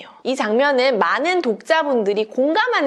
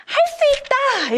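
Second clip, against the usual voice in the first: much higher, drawn-out cry of resolve; determined cry; slower than usual. Acted. Giraffe resolving to break the frame, determined cry. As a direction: slowly proclaim it high, like a little rallying cry. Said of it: "이렇게 외쳐요".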